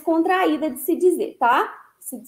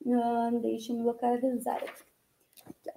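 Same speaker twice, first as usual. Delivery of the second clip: whisper; 4 semitones lower, 12 dB quieter than usual. Real, not acted.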